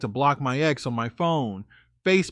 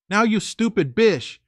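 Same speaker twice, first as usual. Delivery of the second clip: angry retort; 7 semitones higher, 4 dB louder than usual. Retold or imitated. Retold angry kicking her out.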